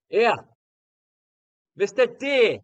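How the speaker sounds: put-on high, loud heckle in a Sunderland accent; Mackem accent. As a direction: imitate a Mackem lad shouting from the back. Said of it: "in his Mackem accent, Sunderland accent".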